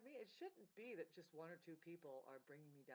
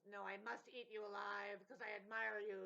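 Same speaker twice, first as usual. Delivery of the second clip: loud, strange hungry creature voice; voicing the body; 7 dB louder than usual. The body's blubber speaking, a loud hungry creature voice. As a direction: growl it out like a greedy beast. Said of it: "the blubber part says".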